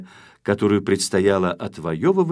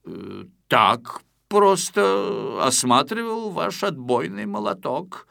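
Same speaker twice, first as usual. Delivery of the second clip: evasive creaky voice; 4 semitones higher, slower than usual. Acted. Caught intruder making excuses.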